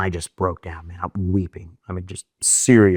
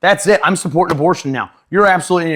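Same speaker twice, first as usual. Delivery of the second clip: high, loud mock conversion; sarcastic mockery; 9 semitones higher, 6 dB louder than usual. Mocking the heckler with a high, loud fake conversion. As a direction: overplay the change of heart to mock him. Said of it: "it just to mock".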